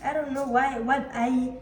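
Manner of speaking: ordinary talk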